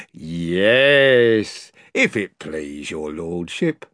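low, slow fawning slaver; wheedling. Acted. Slaver Pug bowing low to the lord, wheedling. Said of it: "in a wheedling kind of voice".

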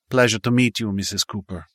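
put-on Italian accent. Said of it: "in his thick Italian accent".

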